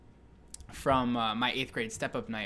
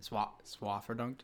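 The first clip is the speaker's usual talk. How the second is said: slow, careful repeat; 3 semitones lower, 8 dB quieter than usual. Real, not acted. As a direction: repeat "Swa-, swaffer dunked?" slowly, softer, each syllable clear. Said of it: "I didn't hear you the first time".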